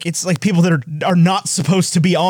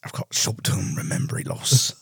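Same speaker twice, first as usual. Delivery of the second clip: gruff whisper; 6 semitones lower, 8 dB quieter than usual. Pretend. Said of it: "Imagine him just being like".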